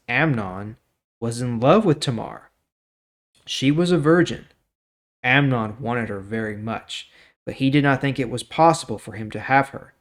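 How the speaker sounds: measured storytelling read of scripture; story reading. Acted. Teacher reading the Bible story to viewers, steady narration. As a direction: read the passage like a story to listeners.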